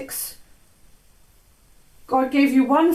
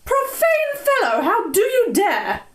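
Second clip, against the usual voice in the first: indignant rebuke; 9 semitones higher than usual. Acted. Offended lady rebukes.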